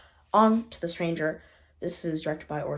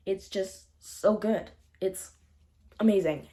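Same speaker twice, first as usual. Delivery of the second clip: normal talking voice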